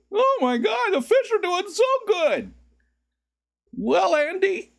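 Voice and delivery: very high, gushing falsetto; mock voice pop. Streamer playing up his popped voice for chat, high falsetto. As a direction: keep squealing in silly falsetto. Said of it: "there my voice popped again".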